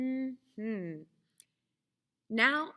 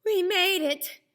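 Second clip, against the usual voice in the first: relieved cheer; 8 semitones higher, 6 dB louder than usual. A narrator's reading. Story character celebrating.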